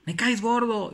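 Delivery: high, loud insult; boy shouting spitefully; angry shout. Retold or imitated. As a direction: yell it at the canyon. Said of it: "empezaba a gritar el niño".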